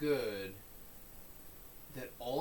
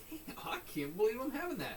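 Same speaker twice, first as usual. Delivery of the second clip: delighted exclamation; 9 semitones higher than usual. Acted. Acting food delight.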